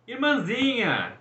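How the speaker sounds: loud, slow, drawn-out call to his sister. A narrator's reading. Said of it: "chamou Apolo". (chamando em voz alta e arrastada)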